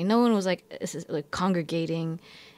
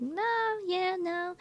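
much higher and softer, brushing it off; high dismissive no; 12 semitones higher, 5 dB quieter, much slower than usual. Retold or imitated. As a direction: turn the idea down gently, pitched way up. People rejecting her suggestions.

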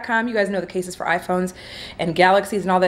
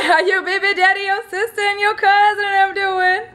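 much higher playful sassy greeting; 13 semitones higher than usual. Acted. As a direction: go up high and sassy. Sassy high greeting to viewers.

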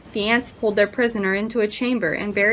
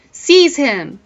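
louder, higher command; sharp, raised-voice command from a queen; royal order; 5 semitones higher, 8 dB louder than usual. A narrator's reading. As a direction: order the guards to grab him. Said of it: "ordered the Queen".

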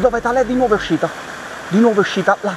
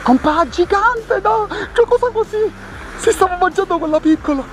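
amazed; 13 semitones higher than usual. Real, not acted. Amazed at a giant trout.

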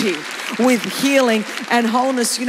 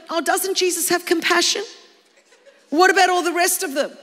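mock outrage; 9 semitones higher, 3 dB louder than usual. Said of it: "what that would have looked like on social media".

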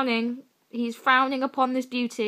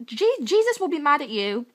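ordinary talk

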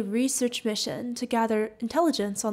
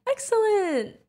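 Excellent! bursts out much higher; light up with joy; thrilled Excellent!; delighted exclamation; 10 semitones higher, slower than usual. Real, not acted. Customer agrees to spend Valentine's.

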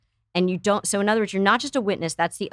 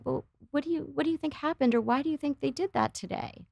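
soft, higher coaxing questions; gentle curious questions; 7 semitones higher, 6 dB quieter than usual. Shown as a demonstration. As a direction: ask softly, inviting the child to think. Modelling gentle questions to a kid.

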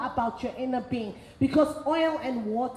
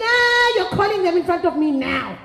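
higher, louder outraged insistence; exclaiming demand; 4 semitones higher, 5 dB louder than usual. Retold or imitated. Friend ordering the woman to call the men now, loud and indignant.

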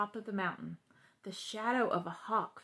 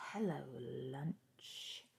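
low, slow, soft menacing snake; 7 semitones lower, 9 dB quieter, much slower than usual. A narrator's reading. Snake greeting Frog and Toad, low slow menace. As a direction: drawl it out like a hungry snake. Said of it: "said the snake".

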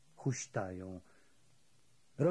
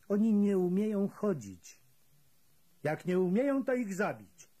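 louder, higher, curt and cold; brutal retort; 9 semitones higher, 5 dB louder than usual. A narrator's reading. Unterscharführer answering the kapo, louder and higher. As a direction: dismiss them harshly.

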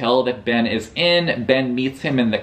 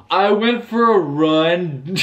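higher, drawn-out feeble excuse; mock sheepish excuse; 4 semitones higher, slower than usual. Cheating husband answers his suspicious wife, mock sheepish and slow. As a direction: stretch out an unconvincing alibi.

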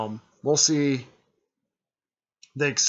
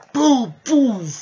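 much higher and louder boom noises; mock explosion; 12 semitones higher, 12 dB louder than usual. The Rasengan hits all three, loud explosion noises. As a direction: blast out the explosion sounds.

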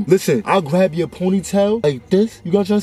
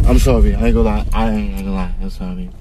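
much lower-pitched, subdued sorry; backing-down apology; 11 semitones lower, 3 dB louder, faster than usual. Real, not acted. Man apologising to passenger after his outburst, subdued apology.

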